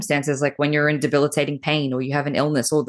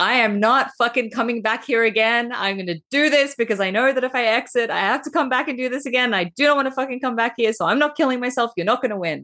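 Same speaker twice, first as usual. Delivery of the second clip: high, fierce refusal to give up; defiant inner voice; 8 semitones higher than usual. Retold or imitated. Stubborn inner self refusing to quit, high and fierce. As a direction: voice it higher, daring the darkness to win. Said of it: "It's like".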